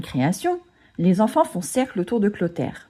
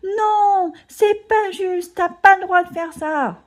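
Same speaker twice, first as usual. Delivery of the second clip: high outcry; 12 semitones higher, slower than usual. A narrator's reading. Agnan interrupting the recital, shrill outcry. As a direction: cry out it's unfair. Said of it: "un cri l'interrompt".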